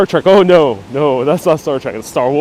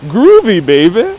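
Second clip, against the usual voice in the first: loud, hammed-up swinger voice; Austin Powers; 5 semitones higher, 4 dB louder than usual. Streamer doing a movie line, loud Austin Powers. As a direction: belt it with a cheeky swagger.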